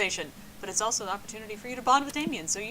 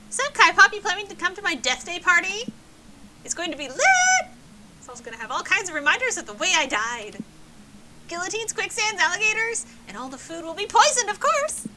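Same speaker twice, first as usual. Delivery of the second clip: put-on loud, much higher party girl; bubbly party girl; 8 semitones higher, 7 dB louder than usual. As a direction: squeal it like a giddy ghost girl. S0 voices bubbly ghost Polly.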